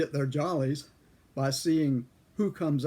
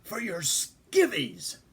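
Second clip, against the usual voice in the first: impatient clerk; 6 semitones higher, 3 dB louder, much slower than usual. Retold or imitated. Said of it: "And he says".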